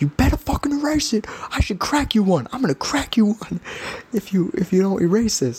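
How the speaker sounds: high, breathy hammed-up threat; mock the husband threatening to hit him; imitating a threat. Husband threatening the podcaster, hammed-up high whispery threat. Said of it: "he was like".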